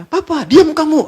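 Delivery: loud, high, slow scolding; snapping at child. Acted. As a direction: bark it at the kid, high and loud. Stressed parent snaps at child. (membentak anak dengan suara tinggi, keras, dan lambat)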